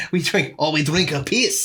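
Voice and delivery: lightly put-on Japanese accent, laughing; a clumsy, joking Japanese accent; bad Japanese accent. Mocking the French drinking piss, bad Japanese accent. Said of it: "I don't know why I sounded Japanese".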